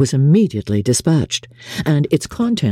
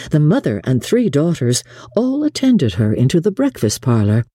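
plain reading voice

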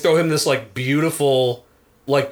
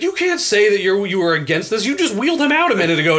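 mock indignant rant; 7 semitones higher, faster than usual. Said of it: "he's like".

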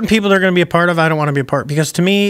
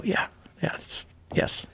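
sheepish hushed admission; 4 semitones higher, 9 dB quieter, slower than usual. Retold or imitated. Admitting identity to a fan.

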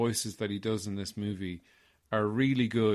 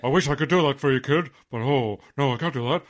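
hammed-up, louder mock apology; lightly voiced actor turning down a kid; 8 dB louder, faster than usual. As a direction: play at regretful refusal, loudly. Acting out [name] declining the request.

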